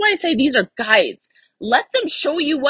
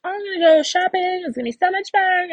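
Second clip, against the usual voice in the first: much higher, louder gushing about shopping; giddy shopper voice, pitched way up; excited shopper; 9 semitones higher, 4 dB louder than usual. Retold or imitated. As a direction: bubble over about going shopping. Imagined excited shopper quote.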